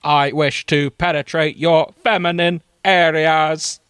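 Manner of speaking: slow, loud, monotone Dalek. Acted. Dalek correcting the heroine, slow Dalek declamation. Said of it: "I specialise in Dalek voices".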